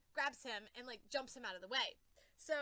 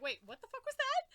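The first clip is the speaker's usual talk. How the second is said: high, loud baffled outburst; startled confusion; 11 semitones higher, 7 dB louder than usual. Retold or imitated. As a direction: react as if you missed something huge. Confused reaction, retold.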